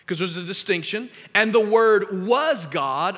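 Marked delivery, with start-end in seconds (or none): 1.3-3.2 slow, loud, was weighted; stress on was. Shown as a demonstration. Pastor reading the verse aloud, slow and emphatic. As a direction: lean on was, slowly.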